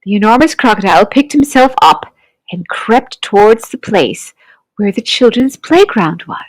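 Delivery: usual speaking voice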